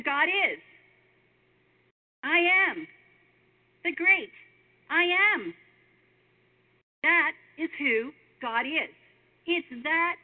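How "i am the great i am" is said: higher and louder, ringing out each word; proclaiming. Acted. Preacher booms God's name to the congregation, loud and high. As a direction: proclaim it like a grand declaration.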